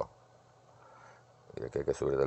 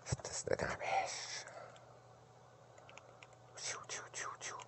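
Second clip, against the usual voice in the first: whisper; 15 dB quieter, much slower than usual. Real, not acted. Whispering while playing arcade.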